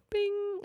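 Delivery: very high, soft ding; bell sound. Retold or imitated. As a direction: squeak a tiny ding. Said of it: "I go".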